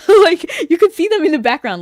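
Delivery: bursts out high and loud through a laugh, fast; laughing excitedly. Real, not acted. Streamer gushing to chat about the hidden comic ending, laughing. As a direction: squeal it with delight, rushing.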